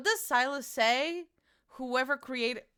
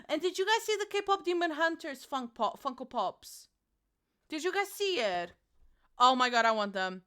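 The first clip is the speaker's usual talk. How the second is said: ordinary talk, nothing marked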